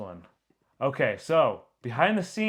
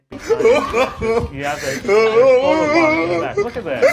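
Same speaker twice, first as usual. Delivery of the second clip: higher and louder, words breaking into laughter; laughing while talking; 11 semitones higher, 10 dB louder than usual. Real, not acted. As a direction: talk through a real laugh. Cracking up at photobomb.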